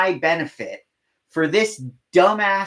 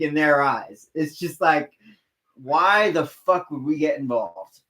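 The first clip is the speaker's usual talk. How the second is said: ordinary talk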